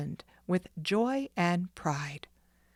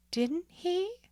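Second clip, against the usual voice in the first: a much higher, small, hesitant ask; timid question; 13 semitones higher than usual. Acted. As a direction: ask shyly, afraid of the answer. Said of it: "timidly asked the boy".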